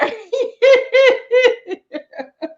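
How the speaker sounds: long high giggle; real laughter. Real, not acted.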